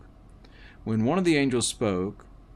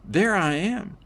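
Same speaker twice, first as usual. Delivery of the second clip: high-pitched excited exclamation at moderate volume; excited shout; 9 semitones higher, 3 dB louder than usual. A narrator's reading. Narrator repeating the cry on seeing their own body, excited. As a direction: exclaim it high, thrilled to spot yourself. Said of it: "I kept shouting".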